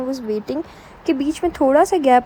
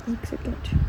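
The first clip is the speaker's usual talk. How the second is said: whispered aside; 5 dB quieter, faster than usual. Real, not acted. Pauses to recall, aside.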